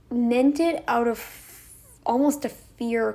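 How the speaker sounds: ordinary talk, nothing marked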